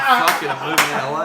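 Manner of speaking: laughing, loud retelling of a bold decision; loud mock quote. Guest retells his bandmate's vow, loud and laughing. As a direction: bellow it as a kid deciding on a whim. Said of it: "so he's like".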